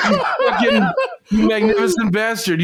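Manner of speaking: delighted laughing shout. Real, not acted. Delighted outburst at friend's post.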